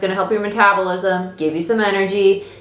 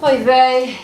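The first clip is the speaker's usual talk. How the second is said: oy vey exclamation; 6 semitones higher, 4 dB louder than usual. Real, not acted. Reacting to a bad card.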